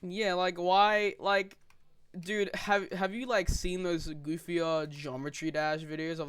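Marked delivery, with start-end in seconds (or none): none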